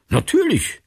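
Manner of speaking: high, surprised falling reply. A narrator's reading. Martin answers the man asking to walk along, surprised. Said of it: "sagte Martin überrascht".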